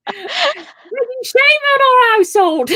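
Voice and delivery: high, slow mock-sobbing lament; hammed-up wailing wife. Wife lamenting the drunk husband's disgrace, hammed-up sobbing. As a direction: wail it like a melodramatic wife.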